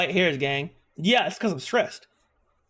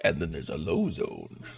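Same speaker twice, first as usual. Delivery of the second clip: much lower, softer rumble; 6 dB quieter than usual. Shown as a demonstration. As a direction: sink into a deep low zone. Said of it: "The shape of my voice".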